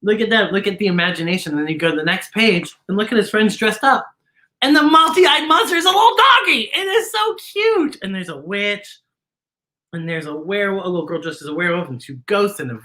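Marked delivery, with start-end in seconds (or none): 4.6-8.0 high, loud, slowing on cute; delighted gushing. Real, not acted. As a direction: gush over the cute dog. Delighted by dog.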